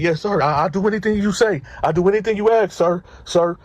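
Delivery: imitating a scared, eager-to-obey cellmate, high-pitched; frightened compliance. Cellmate answering a death threat, mock-frightened. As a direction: answer in a hurried, high, submissive voice.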